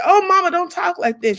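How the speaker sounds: high, loud protest; pleading cry. Retold or imitated. Girl begging her dying mother, high and loud. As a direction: cry out to stop her saying it.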